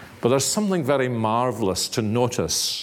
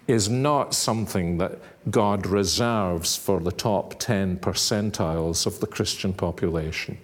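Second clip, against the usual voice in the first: ordinary talk, nothing marked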